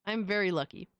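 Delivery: ordinary delivery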